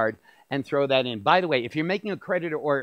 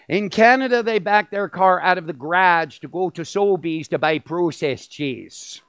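imitated Canadian accent; 4 dB louder than usual. Rabbi mimicking Canadian shoppers for the audience, mock Canadian accent. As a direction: put on a Canadian accent, long "oh" in "processed". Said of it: "they say English words very, very different".

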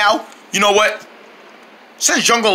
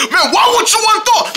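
frustrated; 12 semitones higher than usual. Acted. Frustrated at turkey.

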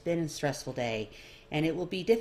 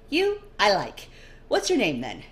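amused nobleman; 4 semitones higher, 6 dB louder than usual. Acted. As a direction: purr it like a charmed aristocrat. Dorian charmed.

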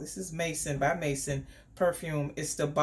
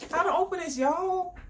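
pitched up, playful plea for help; high pleading; 10 semitones higher than usual. Real, not acted. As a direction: beg the viewers for help. Struggling to open box.

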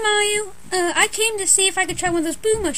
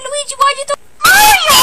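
much higher, loud outraged yell; shocked shout; 8 semitones higher, 10 dB louder than usual. Acted. Scolding Luigi for throwing Mario, loud shout. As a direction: shout it in disbelief.

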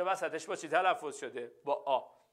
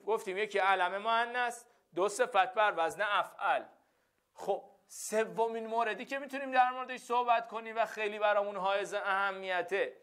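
ordinary talk

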